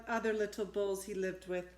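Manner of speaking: usual speaking voice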